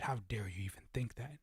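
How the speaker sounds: hissing whisper, rising at the end; mock outraged whisper. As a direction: whisper it like a scandalized accuser.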